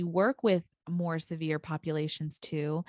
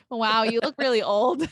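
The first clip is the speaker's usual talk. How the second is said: put-on blunt kid; 4 semitones higher, 6 dB louder than usual. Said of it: "blunt kid quotes".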